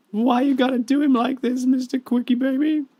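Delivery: high, loud mock whine at the opponent; mock whiny high voice. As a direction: whine it up high like teasing a rival.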